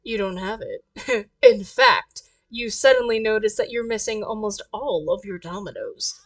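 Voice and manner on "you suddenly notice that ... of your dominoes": ordinary talk, nothing marked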